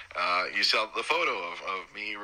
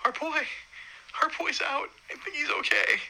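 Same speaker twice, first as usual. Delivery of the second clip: much higher, overjoyed telling; relieved joy. Retold or imitated. Father tells his wife Rosa the baby is out, high overjoyed voice. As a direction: burst out high to your wife. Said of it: "I just turned to Rosa and I'm like".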